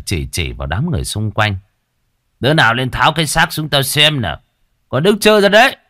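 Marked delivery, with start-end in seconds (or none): 2.4-5.8 rising, bullying command; angry ordering. Acted. Village chief orders bystanders to cut the body down, angry shout. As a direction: build to a loud, high bark.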